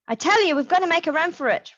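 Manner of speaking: high, fast and urgent plea; urgent Roberts. A narrator's reading. Roberts urging Marisol to flee, high and rushed. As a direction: push him to run for it. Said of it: "Roberts said".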